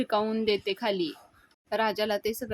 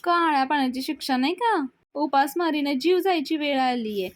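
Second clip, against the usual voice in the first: higher, louder mock grumbling; indignant complaint; 4 semitones higher, 4 dB louder than usual. Hungry guest grumbles at the wedding hosts, indignant complaint. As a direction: play an aggrieved guest complaining.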